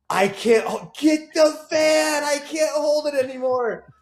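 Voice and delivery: high, slow, stretched-out plea; desperate wife. Retold or imitated. Husband imitating his wife begging for the van, high desperate plea. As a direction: drag it out, pleading.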